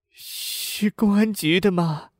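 high, slow, sorrowful woman's voice; grieving woman. A narrator's reading. Bereaved mother asking police about her son's case, grief-stricken. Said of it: "一个悲伤的女声传出来".

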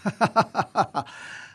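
short high chuckle; light laugh. A narrator's reading. The general laughs at the boy's mistake, lightly. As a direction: laugh it off easily. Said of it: "笑着说".

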